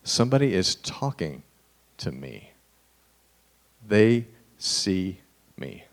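soft, awed, slowing to a whisper; a touch of disbelieving awe; hushed wonder. Retold or imitated. The beggar amazed at being noticed, softly awed. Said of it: "he was like".

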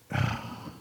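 soft, breathy exhale; heavy sigh. Real, not acted. Preacher pausing over a Savior who hurts with us, heavy sigh. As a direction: let out a weighted sigh. Pauses over Jesus seeing hurt.

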